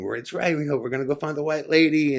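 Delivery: imitating your own pumped-up excitement; stoked. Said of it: "I was stoked".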